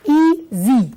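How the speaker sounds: "easy" in two separate beats, ea, then sy; split into syllables. Shown as a demonstration. Teacher shows the adjective has two parts, saying "easy" syllable by syllable. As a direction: break "easy" apart between ea and sy. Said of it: "I can divide it into two".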